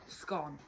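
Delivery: "Scone" with the vowel of hot; short-o scone. Shown as a demonstration. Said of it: "What is the correct pronunciation".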